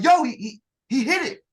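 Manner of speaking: tripping over words at the sight; excited onlooker. Retold or imitated. Fellow recruit watching the target fall, excited. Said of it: "somebody was like".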